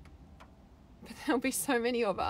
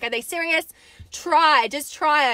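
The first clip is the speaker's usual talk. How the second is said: louder, punchy dare; defiant challenge; 4 semitones higher, 7 dB louder, slower than usual. Retold or imitated. Daring the authorities to stop her, louder and defiant. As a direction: throw down the gauntlet, "just try". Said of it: "I keep saying".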